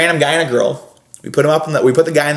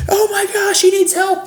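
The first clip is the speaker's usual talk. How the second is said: high, hammed-up panicked cry for help; pitched-up fake panic; mock alarm; slower than usual. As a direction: play the frantic bystander, way up high. Brother's fake alarm in CPR bit.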